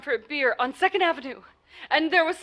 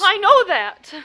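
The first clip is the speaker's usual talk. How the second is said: louder, higher, sharp and impatient; irritated retort; 5 semitones higher, 9 dB louder, slower than usual. Acted. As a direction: cut him off, fed up. Caller snapping back at the sergeant.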